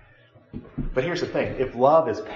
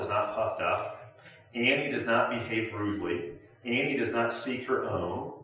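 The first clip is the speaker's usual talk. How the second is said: scripture reading; 6 dB quieter than usual. Acted. Pastor reading the love passage aloud to the group, measured and solemn. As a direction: read it out as a solemn Bible verse.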